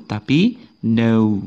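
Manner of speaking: no said slowly without K; slow clear no; slow correct model. Shown as a demonstration. Teacher correcting kno to no, slowly. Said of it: "Bukan kno".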